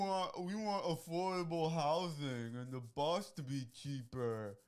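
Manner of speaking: slow, whiny mock demand. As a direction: drag it out like a spoiled complaint. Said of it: "what do they say every single time?".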